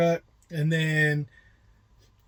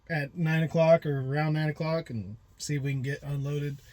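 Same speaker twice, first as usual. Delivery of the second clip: normal talking voice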